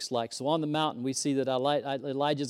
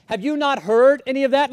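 indignant; 5 semitones higher, 7 dB louder than usual. Retold or imitated. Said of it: "He's like".

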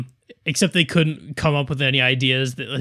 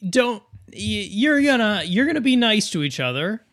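scolding parent; 8 semitones higher, 3 dB louder than usual. Retold or imitated. Parent scolding kids, voiced quote.

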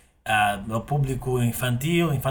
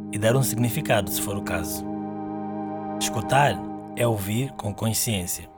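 guided meditation. Acted. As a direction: guide the listener gently into stillness.

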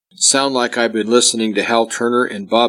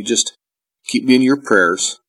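usual speaking voice

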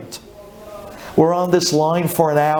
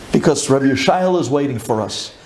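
ordinary talk, nothing marked

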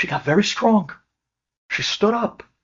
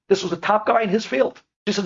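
ordinary delivery

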